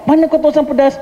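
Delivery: a surprised cousin asks; astonished, pitched high; surprised question. Retold or imitated. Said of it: "terperanjat orang Singapura dengar".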